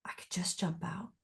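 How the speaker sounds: hushed whisper of a dark inner thought. Retold or imitated. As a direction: breathe it out unvoiced, barely there. Retold dark thought in car.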